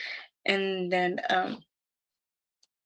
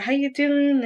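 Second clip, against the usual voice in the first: imitated Chicago accent, pitched up; 6 semitones higher, 3 dB louder, slower than usual. Priest asking the caller how she's doing, imitated Chicago accent. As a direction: imitate a Chicago greeting in a higher voice. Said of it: "he has that Chicago, like, accent".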